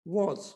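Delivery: 'was' as a short rounded 'voz'. Shown as a demonstration. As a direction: say 'was' short, not long. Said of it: "लंबा नहीं करके बोलना". (छोटा 'वॉज' उच्चारण)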